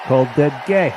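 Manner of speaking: a hint of mocking oratory; mock rally speaker. Co-host echoing the convention speaker's line, mock oratory. Mimics the speech clip just played.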